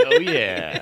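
creepy pleased voice. Pretend. Bezos listening voice.

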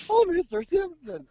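put-on high, loud squeaky-kid voice cracking up; imitating a young boy's cracked yelp; Simpsons kid voice. To the podcast co-host, mocking his own breaking voice as a cartoon kid. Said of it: "I'm about to sound like the kid from The Simpsons".

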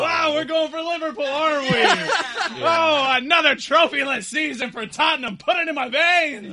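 very high, gleeful whoop with laughter; mock elation. Fan cheers another trophyless Tottenham season, high mock elation. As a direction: squeal it in over-the-top delight.

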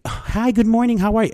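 high, polite, loud office greeting; code-switching voice. Retold or imitated. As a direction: put on the cheery work voice. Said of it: "Y'all see me code switching".

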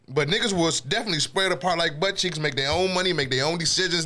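usual speaking voice

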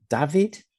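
"David" said DAH-vid, high with a rise; "David" as a high, rising query; questioning pronunciation. Shown as a demonstration. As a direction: try "David" with a short first vowel and a v. Tries ways to say the name.

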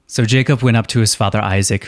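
normal talking voice

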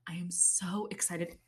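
hushed whisper of glee; soft giddy whisper; excited whisper. Real, not acted. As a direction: whisper the thrill to yourself.

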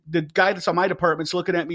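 ordinary delivery

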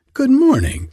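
bright sing-song good morning from a simple gardener; high chirpy hello; high cheerful greeting. A narrator's reading. Hans answers greeting.

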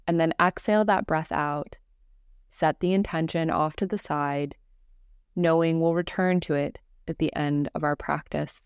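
calm, measured meditation voice with long pauses; guided meditation. Acted. Teacher leading a listener through Yoga Nidra breathing, guided meditation voice. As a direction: guide them gently into rest, evenly and unhurried.

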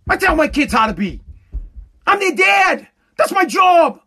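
firm shouted statement; forceful declaration. Real, not acted. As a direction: lay down the law.

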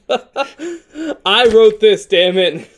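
laughing exasperated protest. Real, not acted. Laughs, insisting he wrote it.

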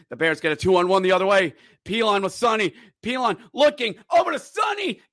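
rising, shouting hockey call; live play-by-play. Real, not acted.

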